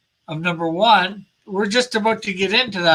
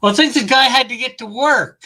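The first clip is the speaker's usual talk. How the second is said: quick, higher, louder take on Jackie; retelling what Jackie said, voiced higher and faster; Jackie's voice; 3 semitones higher, 3 dB louder than usual. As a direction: rattle off the excuse as Jackie. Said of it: "Jackie goes".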